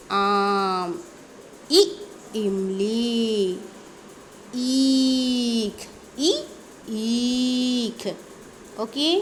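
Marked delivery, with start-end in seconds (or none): 0.1-8.1 drawn-out teacher's vowel drill; slow primer read-aloud, words held long; alphabet recitation. Shown as a demonstration. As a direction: stretch the long vowels on a steady note. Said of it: "पढ़ करके सुनाने वाले हैं".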